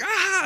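high-pitched mock scream; retold scream. Rider launched down the zip line, mock screaming. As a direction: let out a comic, high yelp of panic. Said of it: "I'm just screaming".

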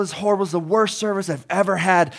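high, loud mock rant at the cashier; a customer reaming out the worker; imitating a furious customer complaining about service; irate customer. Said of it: "And they're like".

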